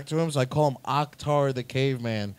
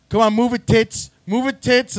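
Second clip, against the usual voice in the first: higher, louder mock command; acted hurrying order; 6 semitones higher, 6 dB louder than usual. Friend orders him to hurry.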